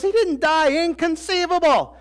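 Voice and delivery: high mock-outraged Inconceivable; Vizzini exclaiming. Preacher imitating Vizzini's indignant cry. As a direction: squeal it in disbelief. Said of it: "Vizzini goes".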